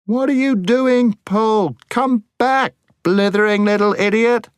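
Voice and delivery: high-pitched, angry yell of alarm; frantic shout. A narrator's reading. Scrub calls Paul back from the cliff edge, frantic high shout. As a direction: shout it high and urgently, scolding. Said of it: "shouted Scrub".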